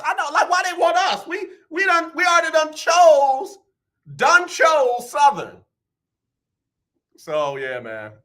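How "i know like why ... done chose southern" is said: high, loud, exasperated insistence; mock indignant outburst. Father protesting that other colleges want them, mock indignant outburst. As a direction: protest it loudly, pitched way up.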